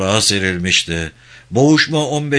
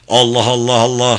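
somewhat raised battle chant; thunderous war cry; 4 dB louder than usual. A narrator's reading. Soldiers charging beyond the hill, a restrained war cry. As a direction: chant it like a distant charging army. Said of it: "gök gürler gibi".

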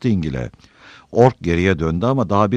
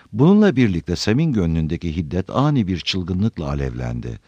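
plain reading voice

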